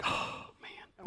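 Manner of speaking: unvoiced, sinking whisper; dismayed whisper. Pastor realizes he is being chased, whispering in dismay. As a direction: whisper as the patrol car pulls out. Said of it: "And I'm like".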